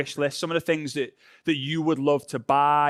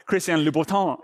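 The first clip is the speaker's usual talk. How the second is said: French accent. Shown as a demonstration. Host trying the designer's name in a mild French accent. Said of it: "That sounded a little bit French".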